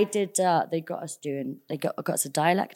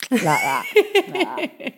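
imitating grandma; 8 semitones higher, 3 dB louder, much slower than usual. Imitating grandma sucking bread.

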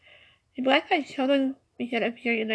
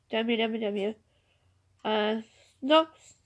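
normal talking voice

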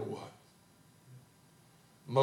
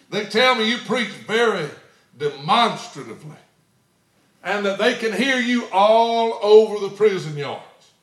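mock loud report; 10 semitones higher, 13 dB louder than usual. Warden repeats loud-preaching report.

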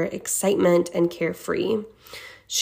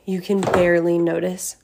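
ordinary delivery

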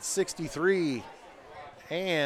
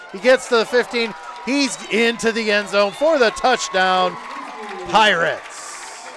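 loud, high touchdown yell; excited touchdown call; 6 semitones higher, 8 dB louder than usual. Real, not acted. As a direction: shout it as he scores.

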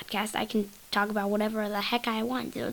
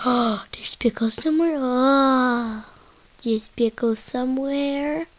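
gasping, louder, rising excitement; excited gasp; 2 semitones higher, 4 dB louder, slower than usual. Pretend. Trips out hearing pickle, gasping excitedly. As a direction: gasp and cry it out in delight. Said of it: "it kinda trips me out".

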